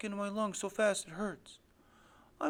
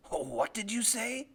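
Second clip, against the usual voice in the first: lightly voiced threatening father; menacing father. Father reaches for his accusing son, lightly menacing voice.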